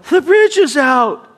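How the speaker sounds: high, loud urgent shout of warning; yelling warning. Retold or imitated. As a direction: call out the danger, loud and high. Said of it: "God's been yelling at you".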